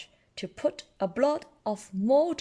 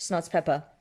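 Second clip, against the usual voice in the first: ordinary delivery, nothing marked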